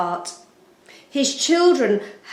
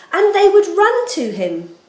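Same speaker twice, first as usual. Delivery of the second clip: ordinary delivery, nothing marked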